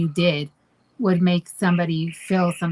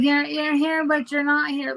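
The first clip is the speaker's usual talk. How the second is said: mocking boyfriend; 5 semitones higher than usual. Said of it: "You're like".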